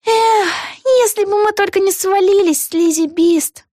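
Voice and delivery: put-on high girlish voice with a rueful sigh; regretful heroine. A narrator's reading. Vega wishing they had not fallen, high rueful girlish voice. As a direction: lament it in a high little-girl voice.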